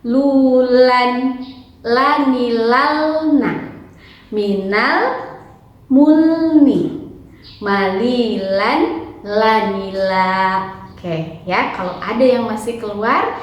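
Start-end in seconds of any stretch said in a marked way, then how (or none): 0.1-10.7 syllables called out high, ending very slow; teacher reciting practice words; practice drill. Shown as a demonstration. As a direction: chant carefully, drawing out the last words. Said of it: "Perhatikan ya".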